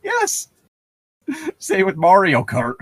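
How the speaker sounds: usual speaking voice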